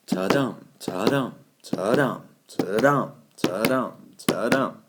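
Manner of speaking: steady "ta-DUM" pulse, DUM punched; heartbeat rhythm. Shown as a demonstration. Teacher likening the meter to a human heartbeat, heartbeat rhythm. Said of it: "Like the human heartbeat".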